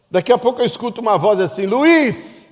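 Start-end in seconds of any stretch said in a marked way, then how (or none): 1.7-2.4 high called-out name across a crowded market; calling out a name. Retold or imitated. Wife calling her husband across the market. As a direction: call his name out as if from afar.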